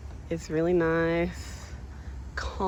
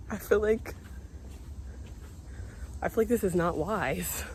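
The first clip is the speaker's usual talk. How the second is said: normal talking voice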